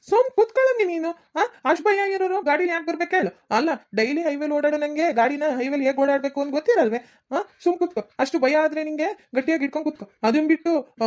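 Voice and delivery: high-pitched, indignant telling-off; exasperated scolding. Acted. Driving grandpa to his scared wife, exasperated scolding. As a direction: scold a nervous passenger into sitting still.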